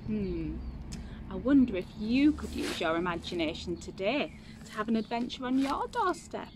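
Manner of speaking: usual speaking voice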